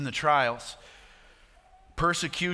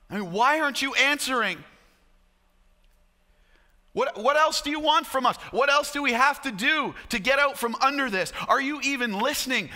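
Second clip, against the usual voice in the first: anguished plea, voice pitched high; acted lament, urgent high-pitched questioning; crying out to God; 7 semitones higher than usual. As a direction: plead desperately with God in a raised, high voice. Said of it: "weeping aloud, crying out to the Lord".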